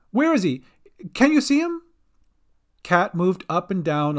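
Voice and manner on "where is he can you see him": high, alarmed questions; crying out. A narrator's reading. Gran searches for vanished Bink, alarmed cry. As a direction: call anxiously, pitch climbing. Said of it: "cried Gran".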